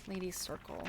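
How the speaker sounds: ordinary delivery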